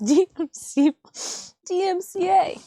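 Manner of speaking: laughing. Real, not acted. Laughing at own wordplay.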